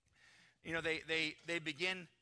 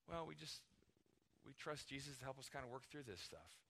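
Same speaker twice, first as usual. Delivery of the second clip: softer, unforced reply; understated answer; 9 dB quieter than usual. Acted. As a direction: answer casually and modestly, no preaching. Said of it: "You could just say".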